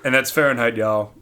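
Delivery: ordinary talk, nothing marked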